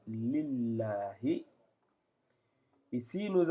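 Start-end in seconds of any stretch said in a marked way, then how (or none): 0.1-1.5 slow, drawn-out Arabic recitation of lillahi; Arabic reading demo. Shown as a demonstration. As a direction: stretch each syllable of lillahi slowly. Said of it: "ça se dit comme ça".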